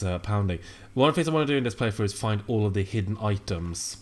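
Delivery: ordinary talk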